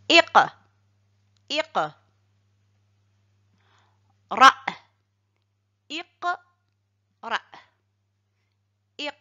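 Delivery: iq and ra split apart with a short stop after each; iq, ra read as separate syllables with a marked stop after each; pause after each syllable. Shown as a demonstration. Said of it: "marquer un petit arrêt après chaque syllabe".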